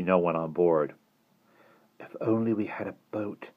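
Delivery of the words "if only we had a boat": soft, despairing wish. A narrator's reading. Jane trapped at the cliff, despairing. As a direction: let it sink into quiet despair. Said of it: "Jane said in despair".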